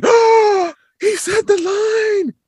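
very high, loud gleeful squeal, last words drawn out; thrilled fan squeal. Retold or imitated. Dean hearing the villain say the line, ecstatic high squeal.

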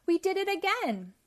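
high, loud victory cry; triumphant cheer. A narrator's reading. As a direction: cheer the second escape. Pac-Man celebrates escaping another maze.

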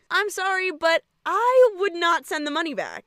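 put-on high, pleading kid voice; a hint of a whiny teen. Sam voiced in recap.

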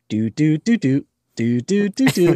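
high, loud do-do-do droid chirps done badly; bad mouse droid noise. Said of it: "he makes the mouse droid noise, but he makes it really poorly".

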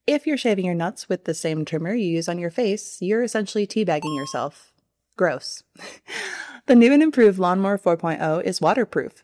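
upbeat sponsor read. Acted. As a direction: sell the product cheekily.

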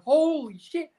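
retold yell. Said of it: "and I just yelled".

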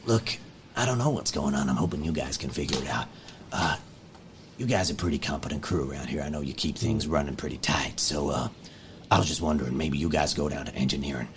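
calm the crowd like a trusted dad figure; calm leader. Acted. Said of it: "he leans in".

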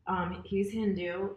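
ordinary talk, nothing marked

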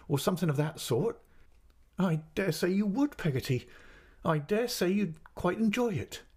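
high-pitched, aggrieved mother; tearful reproach. A narrator's reading. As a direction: scold as if close to tears. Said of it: "shedding more tears than before".

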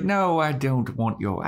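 lightly voiced vampire seductress; Drusilla impression. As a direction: reassure him in an affected high tone. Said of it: "She's like".

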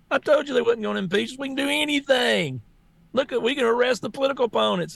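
lightly voiced gloating opponents, quick and high; mocking gloating communists. Speaker mocks opponents laughing at Republicans, in a gloating voice. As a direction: sneer it like smug winners bragging they got away with it. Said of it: "They're like".

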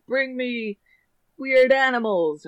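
high, slow and insistent; a bossy showman voice; demanding circus owner. Retold or imitated. Circus guy demands odd animals.

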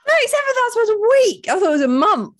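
very high, loud disbelief; high-pitched outraged surprise; shocked shriek. Real, not acted. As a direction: shriek it in astonishment. Shocked reaction to the fart money.

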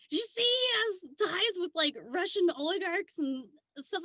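high, loud gossipy friend voice; excited friend. Retold or imitated. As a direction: ham up a breathless gossiping friend. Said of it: "and she's like".

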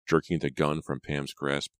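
plain reading voice

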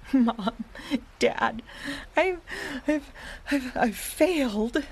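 acted sobs between broken words; tearful, stammering storybook pup; sobbing. A narrator's reading. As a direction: blubber it in halting little bursts. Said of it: "sobbed Power Dog".

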